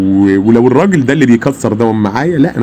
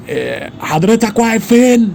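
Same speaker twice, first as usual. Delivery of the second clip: higher, slow, formal officer's question; imitating the officer; 10 semitones higher, slower than usual. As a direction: mimic a stiff officer asking coolly. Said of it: "فقال لي".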